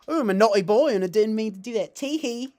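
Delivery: put-on high, loud giggly naughty-boy voice; squealed, hammed-up naughty confession; coy impression. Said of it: "he's like".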